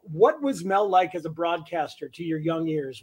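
ordinary talk, nothing marked

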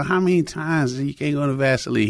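ordinary delivery

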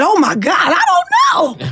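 shrieking high, loud retold panic; panicked shout. Telling her cellmate she has no idea why, panicked high shout. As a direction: yelp it out in disbelief, voice cracking up high. Panicked answer about bond.

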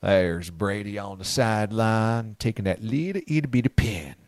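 low, slow, hushed Southern drawl; drawn-out low Southern voice, close to the mic; southern drawl pillow talk. Pretend. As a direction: purr it like a sultry Southern pillow talk host. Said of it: "Southern draws are fun".

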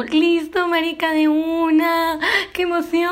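retold excited acceptance of the date invite; mock excitement. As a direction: imitate her own giddy acceptance. Retells her eager reply.

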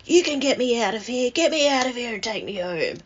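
retold demand, high and fast; demanding plea. Nan to grandchild, wanting out of care, demanding plea. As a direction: imitate Nan urgently pleading to be rescued. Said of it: "she yelled out to me".

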